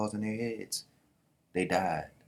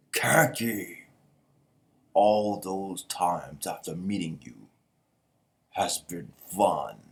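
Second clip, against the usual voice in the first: slow, louder voice with stretched words; solemn character farewell; 2 semitones lower, 4 dB louder, much slower than usual. Acted. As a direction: deliver parting words with slow weight.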